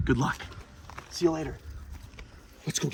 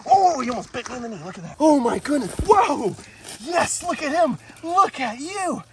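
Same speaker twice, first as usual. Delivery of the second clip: excited; 11 semitones higher, 6 dB louder than usual. Real, not acted. Nearly bitten.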